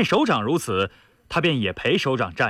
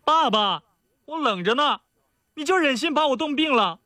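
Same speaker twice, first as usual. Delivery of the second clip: high, whiny pampered daughter; spoiled-daughter whine, calling out; coquettish pleading; 12 semitones higher than usual. A narrator's reading. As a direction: wheedle Dad in a girlish high voice. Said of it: "田宇撒娇地喊道".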